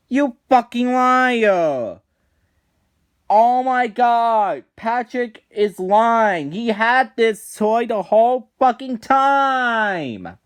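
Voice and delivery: loud, high, slow furious outburst; real fury at a lying cartoon character; outraged rant. Real, not acted. Outraged at Patrick on video.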